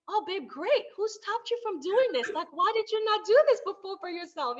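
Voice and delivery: lightly voiced cheerful husband; husband's delighted voice. Husband praising wife for buying phone, mock cheerful. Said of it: "He's like".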